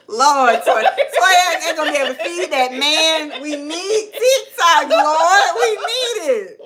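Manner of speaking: high, laughing wail; laughing mock lament. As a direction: wail it while cracking up. Mock lament over TikTok ban.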